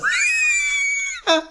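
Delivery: very high, squealed laugh; high-pitched cracking up; helpless laughter. Real, not acted. Cracks up at his own quote.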